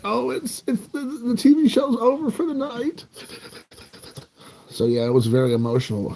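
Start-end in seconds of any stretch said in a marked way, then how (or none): 0.0-3.0 high-pitched hammed-up whimper; put-on tearful squeak; mock weepy. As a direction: pretend to tear up, voice way up high. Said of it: "little weepy".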